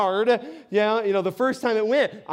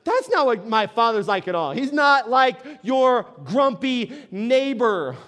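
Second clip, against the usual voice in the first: emphatic shout. Retold or imitated. Jesus correcting the crowd, a strong shout. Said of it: "He's like".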